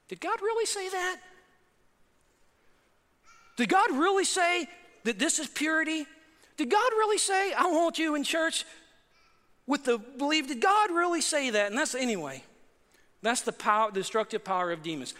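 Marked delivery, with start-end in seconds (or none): 0.1-1.2 much higher, mocking serpent voice; taunting doubt from the tempter; sly tempter. Preacher voices Satan mocking culture. 3.6-11.7 higher, sneering doubt; mocking tempter, pitched up; sly tempter. Preacher voices Satan mocking culture.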